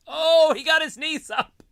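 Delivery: high, loud excited call; excited commentary. Real, not acted. Live play-by-play excitement.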